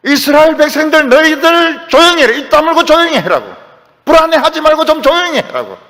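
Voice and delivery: loud, high-pitched order to a crowd; commanding rebuke. Acted. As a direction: call out sternly to hush a whole people. Moses orders the Israelites quiet.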